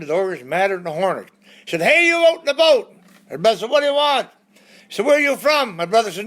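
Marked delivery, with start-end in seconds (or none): 1.8-2.9 high, loud yell across the field; calling out. Retold or imitated. Angry driver yelling at the man in the boat, loud call. 3.7-4.3 high, loud call back across the field; shouting back. Retold or imitated. Brother calling back to the driver from the boat, loud. 5.1-5.8 high, loud shouted question; calling out. Retold or imitated. Angry driver shouting his question at the boat, loud call.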